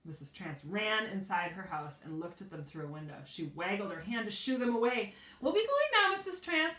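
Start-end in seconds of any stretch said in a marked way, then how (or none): 5.4-6.8 high, loud sing-song call. A narrator's reading. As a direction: call across the yard cheerfully. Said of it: "called Bean".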